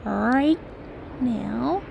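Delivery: low, slow sultry murmur; come-hither voice; seductive. Acted. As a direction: draw him in, slowly and low. Wife propositions husband.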